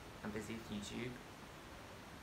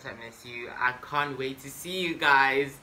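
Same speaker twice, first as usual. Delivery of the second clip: normal talking voice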